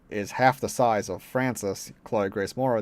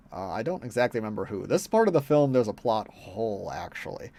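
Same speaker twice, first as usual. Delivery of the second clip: ordinary talk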